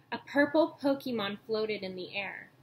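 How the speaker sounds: usual speaking voice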